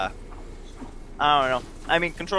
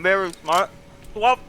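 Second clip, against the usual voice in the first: talking through a mouthful of food; words muffled by a bite of food; mouth full; 4 semitones higher than usual. Real, not acted. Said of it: "I'm talking with my mouth full".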